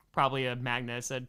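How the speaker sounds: normal talking voice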